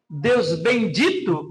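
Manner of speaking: "bendito" said slowly with the ending "ito" drawn out; stress on ito. Shown as a demonstration. Pastor pointing out the rhyme, slow and stressed on "ito". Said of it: "com ênfase no ito".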